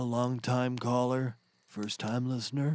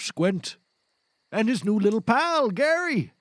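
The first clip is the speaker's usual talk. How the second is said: rising higher and louder to the name; building announcement; 11 semitones higher, 6 dB louder than usual. Acted. Introducing Gary to roast Rodney, climbing high. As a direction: build up to a big reveal.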